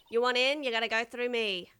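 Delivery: loud, blunt guard voice; gruff security guard. Acted. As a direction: block the gate like a tough bouncer. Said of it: "I don't know why this is the voice that came to me".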